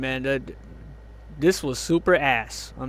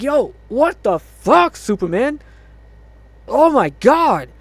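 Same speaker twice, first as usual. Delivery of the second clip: slow, loud, higher-pitched disbelief; exasperated outburst; 6 semitones higher, 4 dB louder, slower than usual. Retold or imitated. Viewer scolding Superman for crying, exasperated. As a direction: act out being fed up, drawing the words out. Acts out his reaction to Superman.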